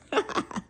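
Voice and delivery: short stifled giggle. Real, not acted. Reader reaching a suggestive line, giggling. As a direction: giggle mid-sentence.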